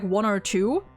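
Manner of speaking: normal talking voice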